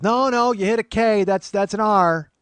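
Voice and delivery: high, loud imitated aunt; alarmed correction. Aunt spotting a typo over nephew's shoulder, loud nagging. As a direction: cry out the mistake as the hovering aunt.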